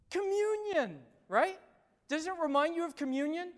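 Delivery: high, loud and fast answer; spring the answer on them; excited reveal. Real, not acted. Pastor answering his own question, excited.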